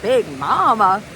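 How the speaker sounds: a hint of Southern accent on Big Mama, said slowly; little Southern accent. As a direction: imitate husband's teasing Southern Big Mama. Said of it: "a little Southern accent".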